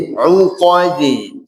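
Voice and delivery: slow 'UQADI, rounded 'u, unrounded qo; pout on u only. Shown as a demonstration. Teacher corrects lip shape, 'Uqadi slow. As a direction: pout 'u, then relax lips for qa. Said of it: "O-nya yang monyong".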